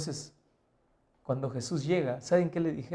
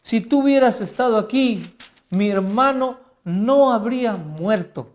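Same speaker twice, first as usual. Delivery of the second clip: high, loud, slow pleading; grieving sister crying out to Jesus, pleading; tearful plea; 5 semitones higher, 9 dB louder than usual. Retold or imitated. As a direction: beg him through tears, drawn out. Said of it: "Lloraba, lloraba".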